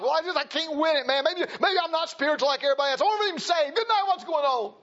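high, exasperated acted lament; overwhelmed man's high-pitched complaint; worn-out struggler. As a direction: pour out frustration in a rising rush. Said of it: "he got wore out and weary".

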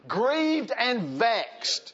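heavy sighs, then high drawn-out exasperation; huff, then draw the words out high; grieved and vexed. Acted. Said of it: "you're gonna be like this".

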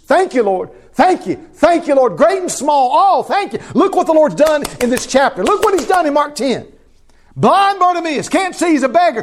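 high, loud fervent praise; preaching at full force. Real, not acted. Preacher praising the Lord to his congregation, full force. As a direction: lift it up in full voice.

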